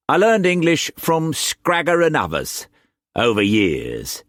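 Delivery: Cockney swagger, pitched well up and pushed; harsh Cockney accent. A narrator's reading. Wu Kwok pressing food on Struan, in harsh Cockney accent. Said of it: "the harsh Cockney accent".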